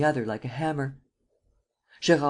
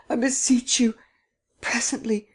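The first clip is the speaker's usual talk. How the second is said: whispered plea; 9 semitones higher than usual. Acted. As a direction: beg in a frightened whisper. Terrified pleading.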